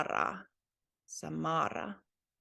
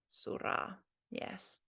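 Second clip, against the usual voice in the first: normal talking voice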